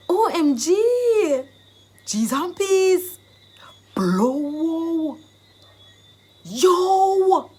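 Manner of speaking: high, loud, long-drawn astonishment; amazed exclamations. Shown as a demonstration. Teacher acting out an OMG reaction, loudly astonished. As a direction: stretch each exclamation in shock. Acted shock in phrase demonstration.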